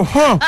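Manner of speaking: laughing gasp. Retold or imitated. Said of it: "y yo".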